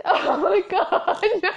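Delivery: slow, laughing disbelief; helpless giggling dismay. Retold or imitated. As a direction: draw it out while cracking up. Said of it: "I said to Jax".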